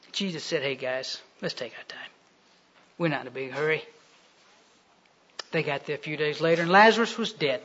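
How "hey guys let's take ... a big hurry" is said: very soft, low, laid-back reply; unhurried calm. Acted. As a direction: take all the pressure off, quietly. Jesus unhurried, answering urgent plea.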